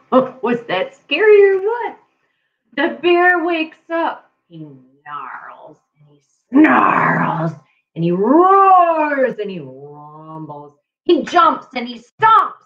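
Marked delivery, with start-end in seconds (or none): 4.5-10.7 growly reading. A narrator's reading. Reading the angry bear waking.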